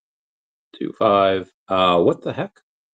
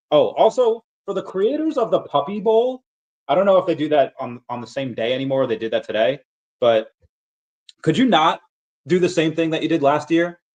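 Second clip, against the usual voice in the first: worked-up plea; 6 semitones higher than usual. Real, not acted. Pleading to Puppy Bowl creators.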